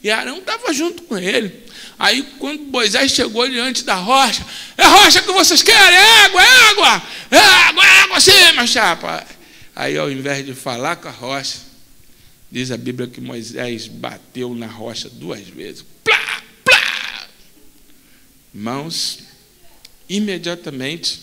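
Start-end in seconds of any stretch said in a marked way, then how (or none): 4.8-9.2 high, loud joyful cry; exultant loud high shout; triumphant shout. Acted. Moses proclaiming water at rock. 16.0-17.3 rock-striking sound effect. Acted.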